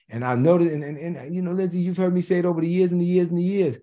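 ordinary talk

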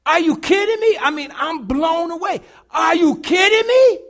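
loud, high, angry exclaiming; outraged disbelief. Retold or imitated. Preacher reliving anger at the homeless man, loud and high. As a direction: rant in shocked disbelief.

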